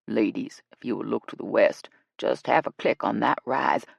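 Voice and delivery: low-voiced official guide; tour guide. Guide pointing the ladies west, low voice. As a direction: direct them in a deep voice.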